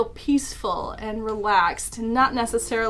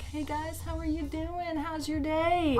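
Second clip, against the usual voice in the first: very soft, higher-pitched soothing hush; imitated calming whisper; 5 semitones higher, 8 dB quieter than usual. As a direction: imitate an ASMR creator gently greeting viewers. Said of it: "whispering in a calming tone".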